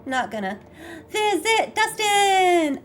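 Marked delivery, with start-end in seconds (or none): 1.1-2.8 much higher, slow, stretched-out squeal; excited cry. Real, not acted. Choosing to visit Dustin, high excited squeal. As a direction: call it out with joy. Excited to see favourite character.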